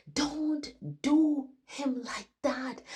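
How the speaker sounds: high, loud and slow, anguished; retold scream. Dreamer screaming at staff behind glass doors, high slow anguished shout. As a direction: drag out the plea in a high, loud voice. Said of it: "And I was screaming".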